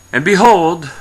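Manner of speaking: loud, high, grand announcement; dramatic reveal. A narrator's reading. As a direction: proclaim it like a grand unveiling. Dramatic reveal of the wizard's castle.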